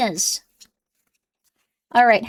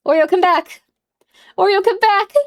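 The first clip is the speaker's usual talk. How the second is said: higher, louder call to the cat; 8 semitones higher, 5 dB louder than usual. Real, not acted. Calling the cat back, higher and louder. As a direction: call her back across the room.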